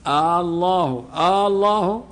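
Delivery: آه الله with the opening hamza stretched into a long questioning ā; drawn-out hamza. Shown as a demonstration. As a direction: stretch the first vowel of الله so it sounds like a question. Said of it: "فمن الأخطاء مد الهمزة".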